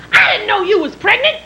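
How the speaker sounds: high-pitched mock lady; brash stranger. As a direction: blurt it out in a high put-on voice. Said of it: "She said".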